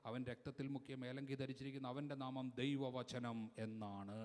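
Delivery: preacher's reading building to full force; scripture proclamation. Acted. Preacher reads Revelation's heavenly vision to the congregation, building to loud proclamation.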